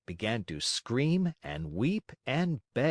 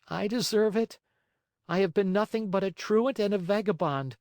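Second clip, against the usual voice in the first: put-on high-pitched puppet-boy lament; weak, remorseful cry; 9 semitones higher than usual. A narrator's reading. Chained marionette blames himself in the dark, high and remorseful. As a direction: lament your faults in a high voice. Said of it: "cried out in a weak voice".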